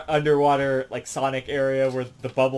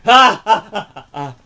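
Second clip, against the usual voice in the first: loud, higher villainous cackle that fades; hammed-up gloating laughter; mocking laugh; 9 semitones higher, 14 dB louder than usual. Shooting the dog while laughing at it, mock cackle. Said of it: "he's like".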